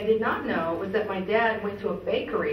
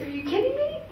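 much higher, squeaky mock amazement; mock disbelief; 14 semitones higher, faster than usual. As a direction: hit it way up high in disbelief. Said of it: "It's like".

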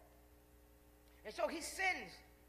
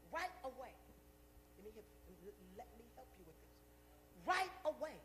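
ordinary delivery

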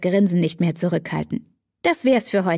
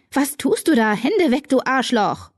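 angry outburst; 5 semitones higher than usual. A narrator's reading. Katie snaps at Damon.

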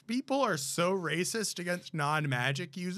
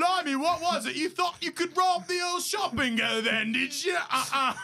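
cheeky Cockney taunt, pitched up; Cockney Stan Shunpike; 8 semitones higher than usual. Acted. Friend does Stan Shunpike from the Night Bus, in Cockney. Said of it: "like a Stan Stunpike impression".

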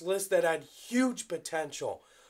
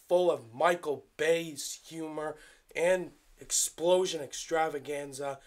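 normal talking voice

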